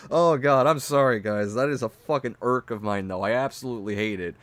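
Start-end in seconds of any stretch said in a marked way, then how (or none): none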